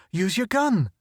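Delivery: high urgent plea; urging. Acted. Photographer urging Irish to shoot, high urgent. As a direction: push him to act.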